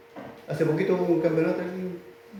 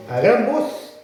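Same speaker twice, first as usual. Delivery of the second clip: raised, higher emphatic voice; loud voice; 7 semitones higher, 4 dB louder, much slower than usual. Acted. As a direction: call it out big and high.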